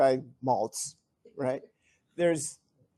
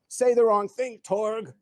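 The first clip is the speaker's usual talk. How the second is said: higher, louder angry warning; angry threat; 6 semitones higher, 4 dB louder, slower than usual. Retold or imitated. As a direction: threaten him, furious. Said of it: "'Cause he's so angry".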